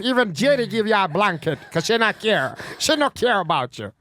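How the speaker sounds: lightly put-on Jamaican accent, teasing; hint of a Jamaican girl, playful. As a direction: loose Jamaican patois lilt. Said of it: "You want me to do Jamaican girl?".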